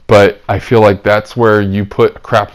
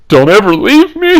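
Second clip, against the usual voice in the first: mock clingy plea; 11 semitones higher, 5 dB louder than usual. Jokey sign-off plea to listeners.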